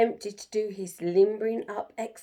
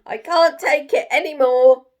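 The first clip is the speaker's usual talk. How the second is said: loud, fed-up protest easing at the end; crying out; 4 semitones higher, 9 dB louder than usual. Acted. Jim tells the animals to move out, loud exasperated. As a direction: let it all out, then lay down the law. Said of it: "cried Jim".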